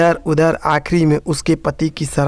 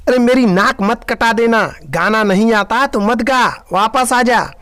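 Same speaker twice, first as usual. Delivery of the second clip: shouting; 7 semitones higher than usual. Acted. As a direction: yell at her to come back down. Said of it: "सास जोर से चिल्लाई". (सास का बहू पर ऊंची आवाज़ में चिल्लाना)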